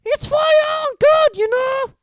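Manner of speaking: very high, loud mock-innocent voice; saintly poltergeist. A narrator's reading. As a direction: play the little saint, falsely sweet. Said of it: "in a saintly voice".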